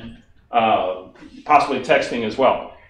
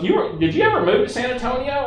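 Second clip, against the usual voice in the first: much higher curious caller; Barbara's voice; 10 semitones higher than usual. Retold or imitated. Barbara asks Steve if he moved, higher voice. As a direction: ask it up high and friendly. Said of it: "I'm gonna talk like Barbara for a minute".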